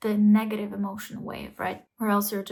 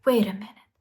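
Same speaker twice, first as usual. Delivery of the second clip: whisper; 3 dB louder than usual. Acted. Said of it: "you're like".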